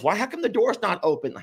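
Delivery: ordinary talk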